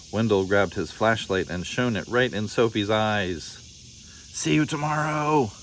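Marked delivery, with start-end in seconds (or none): 4.3-5.5 higher, teasing voice with a smile; a smug little tease at bedtime; sly smiling boy. A narrator's reading. Wendell teasing Sophie at lights out, smug and sing-song. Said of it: "he said, smiling".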